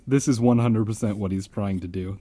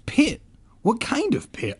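very high squealing panic; hammed-up screaming kid; mock scream; 12 semitones higher than usual. A narrator's reading. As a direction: shriek it in fake terror. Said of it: "I screamed".

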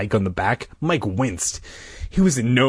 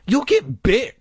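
high, emphatic warning; 14 semitones higher, 3 dB louder than usual. A narrator's reading. Colin warning friends about the snakes, high and emphatic. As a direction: warn sharply, pitched well up. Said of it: "Colin warned".

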